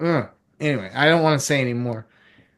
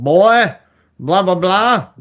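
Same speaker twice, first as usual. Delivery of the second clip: drawn-out, louder mock Southern thriller voice; Southern accent; 3 semitones higher, 5 dB louder, slower than usual. Fan mimicking Southern thriller dialogue, Southern accent. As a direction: ham up a Southern good old boy. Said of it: "Give me accents".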